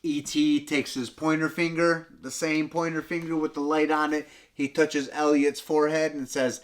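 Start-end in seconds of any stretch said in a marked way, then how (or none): none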